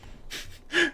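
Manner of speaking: breathless high giggle; real amused giggle. Real, not acted. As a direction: giggle in surprise. GM laughs at player's insult.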